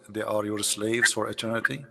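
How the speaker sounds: usual speaking voice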